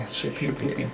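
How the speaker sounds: very soft repeat, fading out; whispered echo. Shown as a demonstration. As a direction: breathe the word Shapoopy almost silently.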